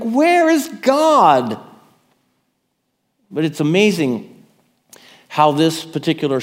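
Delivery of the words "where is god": desperate cry. Shown as a demonstration. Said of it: "Can you feel the desperation?".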